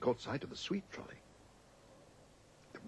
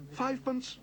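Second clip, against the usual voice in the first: much higher, eager cry; excited cry; 13 semitones higher than usual. A narrator's reading. Paddington eagerly crying out his tiny bid. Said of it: "cried Paddington excitedly".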